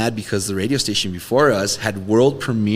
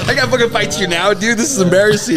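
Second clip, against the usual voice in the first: mock tough guy; 10 semitones higher, 4 dB louder than usual. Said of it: "they'd be like".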